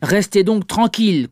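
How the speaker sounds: put-on annoyed schoolmaster snapping at the fidgeting boy; indignant teacher. A narrator's reading. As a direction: scold the boy sharply. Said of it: "le professeur indigné".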